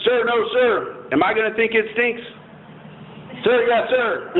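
1 recruit barking reply. Retold or imitated. Recruit answering drill instructor.

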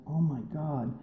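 stunned. Retold or imitated. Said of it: "I just was like".

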